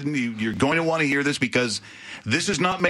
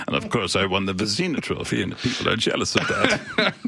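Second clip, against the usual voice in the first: Kipper character voice. Pretend. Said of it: "he would talk like this".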